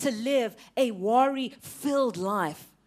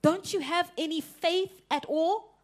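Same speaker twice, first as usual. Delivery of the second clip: slow question; 5 semitones higher than usual. Acted. Repeated for emphasis.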